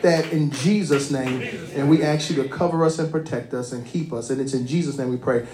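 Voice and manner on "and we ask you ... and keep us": ordinary talk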